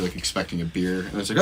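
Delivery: ordinary talk, nothing marked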